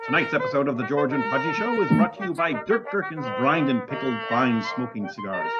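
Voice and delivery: ordinary talk, nothing marked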